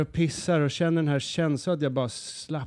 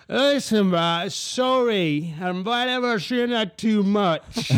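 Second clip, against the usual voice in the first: mock drunk Australian; 7 semitones higher, 6 dB louder, slower than usual. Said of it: "han bara sitter och skriker".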